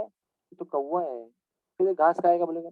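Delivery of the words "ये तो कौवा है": put-on soft, slow, gentle answer to a child; mother's tender reply to her child; patient mother. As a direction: answer softly, endlessly patient. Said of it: "never she is irritated".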